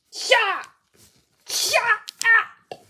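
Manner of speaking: high, loud "shut up"; angry shout. Acted. Skit character silencing the others mid-argument, angry shout. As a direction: shout them down in two sharp bursts.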